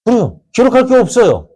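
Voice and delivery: high, ringing preacher's answer; emphatic answer. Real, not acted. Preacher answering his own question, pitched high. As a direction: throw the answer up high and bright.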